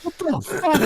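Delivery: very high, laughing disbelief; baffled disbelief. Retold or imitated. Pilot seeing the dead propeller, retold disbelief. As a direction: retell the shock with pitch leaping up.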